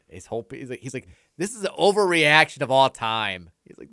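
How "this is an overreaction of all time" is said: imitating an upset friend, loud and higher; mock-indignant outburst. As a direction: protest loudly, retelling a friend's outrage. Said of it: "Jeff was very upset".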